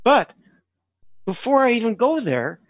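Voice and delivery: ordinary talk, nothing marked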